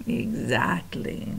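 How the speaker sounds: slow, soft villain purr; drawn-out sinister agreement; hushed gloating rat; sly rat voice. Acted. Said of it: "Botticelli laughed".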